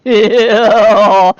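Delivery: loud, drawn-out mock English accent; exaggerated English-accent impersonation. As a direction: belt it out in a hammed-up English accent. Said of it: "it's in this English accent".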